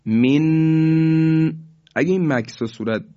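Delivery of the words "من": من with the nun held and drawn out, slightly higher; wrong held nun. Shown as a demonstration. Teacher showing students the wrong way, held nun on من. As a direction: pause and hold the nun of من, the mistake to avoid.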